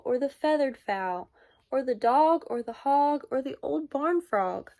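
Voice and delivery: usual speaking voice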